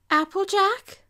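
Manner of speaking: high, lightly voiced Rarity; gentle Rarity voice. Rarity approaches softly.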